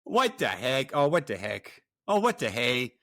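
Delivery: hammed-up Minnesota accent. Shown as a demonstration. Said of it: "say it with that accent".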